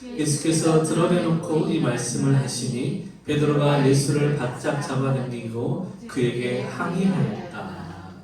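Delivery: slow, measured reading in unison; group scripture reading. Acted. 목사와 회중이 함께 성경 구절을 천천히 낭독. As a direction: read the verse slowly together. Congregation reads scripture aloud.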